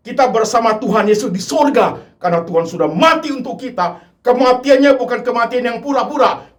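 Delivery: high, loud, insistent altar call; full-force preaching. Acted. The preacher urges unbelieving listeners to accept Jesus, loud and insistent. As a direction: drive it home, urging them to decide.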